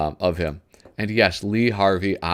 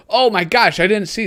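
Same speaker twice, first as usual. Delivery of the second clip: surprised; 11 semitones higher, 4 dB louder than usual. Real, not acted. Suddenly spots detail in drawing.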